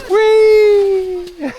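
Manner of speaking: high gleeful whee; excited. Real, not acted. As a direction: shout with joy on the slide. Sliding down the volcano snow.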